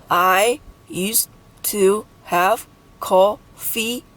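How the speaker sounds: "I used to have coffee" in slow, separated syllables. Shown as a demonstration. Teacher totting up syllables, "I used to have coffee" syllable by syllable. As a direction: leave a gap after each syllable of "I used to have coffee". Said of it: "Eleven syllables in this English sentence".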